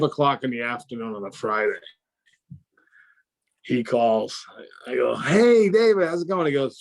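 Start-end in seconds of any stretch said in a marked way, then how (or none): 5.2-6.5 high, loud, cheery hello; re-enacted bright, eager phone greeting. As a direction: greet the boss with hopeful gusto. Cheerfully answering boss's expected good-news call.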